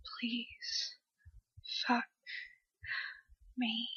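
barely audible breathy plea, drawn out; breathy begging. Acted. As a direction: breathe each word apart, pleading. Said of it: "begging for it with breathy voice".

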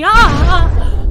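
loud sudden cry of pain; sharp gasp of pain. Acted. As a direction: cry out as if struck. Captive suddenly seized by force.

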